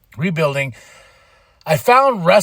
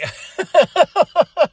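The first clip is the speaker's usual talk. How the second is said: a sudden high laugh at himself; high burst of laughter; 12 semitones higher, 4 dB louder than usual. Real, not acted. Laughing at forgetting his own point, high burst of laughter.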